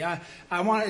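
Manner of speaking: usual speaking voice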